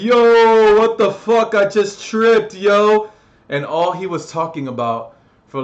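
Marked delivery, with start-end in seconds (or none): 0.0-3.1 high, loud hammed-up yell; mock yelling kid. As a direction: holler it like the kid who tripped. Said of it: "He's like".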